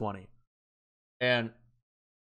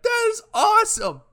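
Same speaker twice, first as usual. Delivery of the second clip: high, loud delighted shout; thrilled shout; 6 dB louder than usual. Retold or imitated. Drunk teen cheering after a cop lets them go. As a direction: yell it like the best news ever. Friends furious, he's thrilled.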